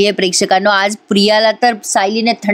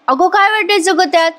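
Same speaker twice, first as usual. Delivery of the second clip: much higher, careless tone; brushing off the rival's news, high and casual; dismissive; 8 semitones higher than usual. Acted. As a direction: shrug it off. Sayli shrugs off the news.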